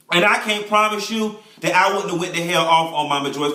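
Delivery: usual speaking voice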